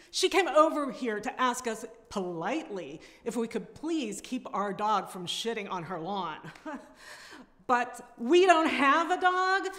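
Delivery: fed-up neighbor rant that keeps climbing; Sharon's tirade. Acted. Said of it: "I am reading Sharon's tirade".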